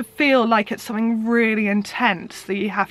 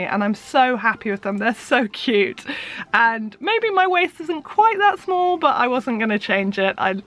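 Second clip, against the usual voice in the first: ordinary talk, nothing marked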